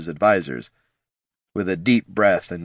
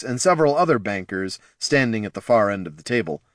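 usual speaking voice

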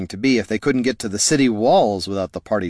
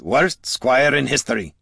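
gruff dwarf voice; 4 semitones higher than usual. Acted. Gorm grumbles.

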